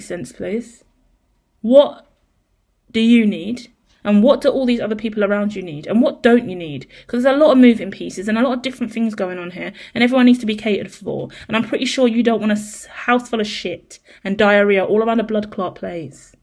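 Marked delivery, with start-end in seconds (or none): none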